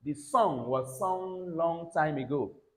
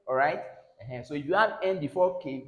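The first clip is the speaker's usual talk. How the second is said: ordinary delivery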